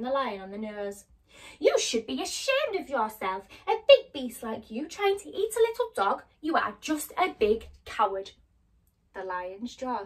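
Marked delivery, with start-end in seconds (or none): 1.6-8.3 loud scolding, pitch climbing. Acted. Dorothy scolds the lion for bullying Toto, loud. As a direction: tell off the big beast.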